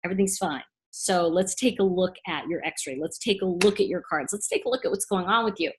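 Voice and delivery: soft, calm reassurance; quiet soothing reader voice; reassuring. Shown as a demonstration. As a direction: gently settle a nervous client. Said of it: "you want somebody to say to you".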